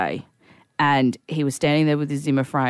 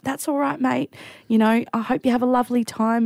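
higher, tearful reassurance; welling up; 7 semitones higher than usual. Retold or imitated. Helper wishing elderly man well, welling up. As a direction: reassure him while tears rise. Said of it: "I'm just welling up".

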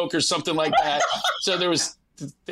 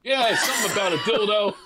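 giggling Kim. Retold or imitated. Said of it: "Kim's going".

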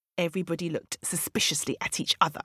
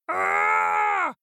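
high-pitched roaring yell; drawn-out acted roar; lion's roar; 11 semitones higher, 4 dB louder than usual. A narrator's reading. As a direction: roar like an angry storybook lion. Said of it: "Lion let out a loud roar".

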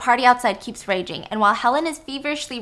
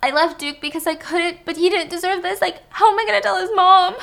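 mock sobbing; 8 semitones higher, 4 dB louder than usual. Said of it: "full on sobbing".

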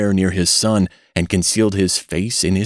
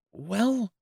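high, soft hesitant well; 8 dB quieter than usual. Acted. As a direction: hang on the word unsure. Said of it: "he hesitated".

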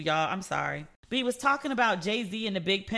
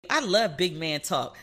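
normal talking voice

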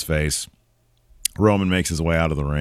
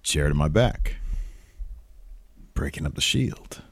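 low, hushed retold line with long pauses; under his breath; 6 semitones lower, 7 dB quieter than usual. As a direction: say it quietly, half to yourself. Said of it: "just under his breath a little bit".

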